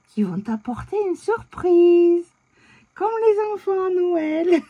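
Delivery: high, slow, playful mystery voice; playful storytelling voice. Retold or imitated. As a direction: tease like telling a child a secret. Teasing partner about prank elves.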